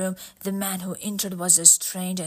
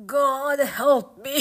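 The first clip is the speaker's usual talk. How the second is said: anguished cry; 6 semitones higher, 5 dB louder, slower than usual. Acted. Dying man confesses in despair.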